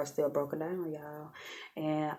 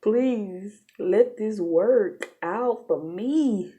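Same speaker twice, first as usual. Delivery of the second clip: pleading prayer; 5 semitones higher, 5 dB louder, much slower than usual. Retold or imitated. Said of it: "I'm praying".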